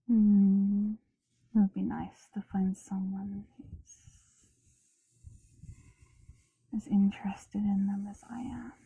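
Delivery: soft unvoiced whisper. Real, not acted. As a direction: breathe the words intimately. ASMR whisper to listener.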